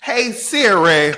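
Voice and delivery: slow, high, loud mock Siri call; imitating her Siri voice. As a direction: drag out Hey Siri teasingly. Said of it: "I sound like Freddie Jean".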